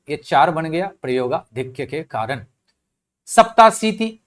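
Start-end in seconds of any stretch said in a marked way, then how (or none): 3.3-4.2 सप्ताशीति with every syllable sounded, high and loud; full original form. Shown as a demonstration. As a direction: pronounce सप्ताशीति in full, middle syllables intact. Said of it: "सप्ताशीति का बीच का गायब हो गया".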